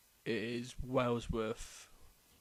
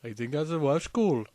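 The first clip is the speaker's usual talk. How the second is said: put-on Welsh accent; 3 semitones higher, 7 dB louder than usual. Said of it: "talking in a Welsh accent".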